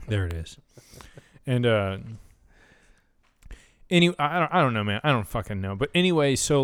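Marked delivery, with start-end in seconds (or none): 0.1-0.6 low, right after a burp; just after burping; talking after a burp. Real, not acted. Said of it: "I keep burping".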